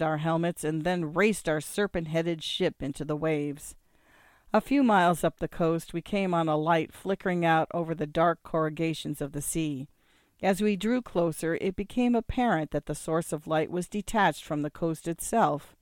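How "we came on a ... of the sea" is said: usual speaking voice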